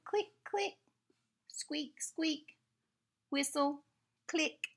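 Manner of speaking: high squeaky "click, click, squeak"; dolphin noises. Acted. Reading aloud to children, imitating dolphin sounds, high and squeaky.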